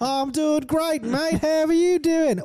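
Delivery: very high hammed-up Australian greeting; mock Aussie accent. Friend mocking lonely streamer's Australian persona, very high. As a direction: chirp it like a cheery Aussie mate. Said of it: "develop multiple people to talk to".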